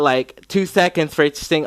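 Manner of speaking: ordinary talk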